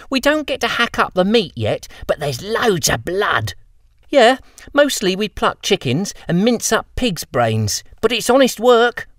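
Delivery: high, bright-voiced girls chattering; young butcher girls. Girl apprentices boasting to the boys about work, high girlish voice. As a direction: girls proud of their messy job.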